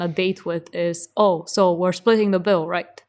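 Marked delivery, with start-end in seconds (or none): none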